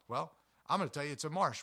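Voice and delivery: usual speaking voice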